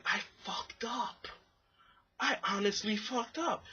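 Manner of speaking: high, drawn-out mock lament; retold regret. Thinking back on the failed date, mock regret. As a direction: lament it high, as an acted thought. Retelling his regretful thought after the day.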